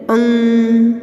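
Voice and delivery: अंग held on a steady nasal hum; अंग sounded long through the nose; nasal anusvar demo. Shown as a demonstration. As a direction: stretch the half-n sound in अंग. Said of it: "इसका उच्चारण हम नासिका द्वारा करते हैं".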